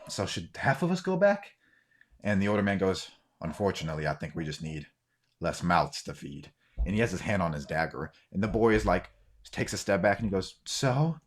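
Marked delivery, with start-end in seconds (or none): 0.1-1.5 put-on higher-pitched boy's question; young boy. Boy questions the older man. 3.4-6.5 put-on low, soft old man's threat; menacing older man. Older man threatens boy, hand on dagger. 10.6-11.2 put-on higher, questioning young voice; uneasy boy. Boy steps back, questioning.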